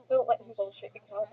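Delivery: ordinary talk, nothing marked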